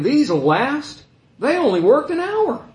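high, loud, whiny complaint from the laborers; whining grumbler. Retold or imitated. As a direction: moan it like a worker cheated of his pay. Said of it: "You can hear people complaining that way".

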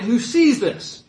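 usual speaking voice

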